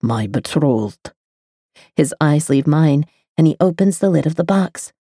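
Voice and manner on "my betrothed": lower, very slow male voice; slow low man. A narrator's reading. The lover naming his lost bride, slow and low. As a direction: let the word sink, slowly and low.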